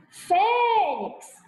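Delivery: "Fênix" called out, first syllable high and held long; calling out the word. Shown as a demonstration. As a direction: stretch the FÊ of "Fênix" as if calling someone. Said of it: "chama a palavra". (chamando "Fênix", com a primeira sílaba aguda e alongada)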